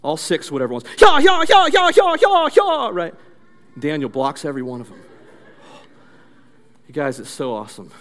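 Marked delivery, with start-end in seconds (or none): 1.0-2.9 rapid volley of high kiai shouts; loud, repeated martial arts yells; karate attack cries. Acted. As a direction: yell out strike after strike. Acting out Miyagi's rapid attacks.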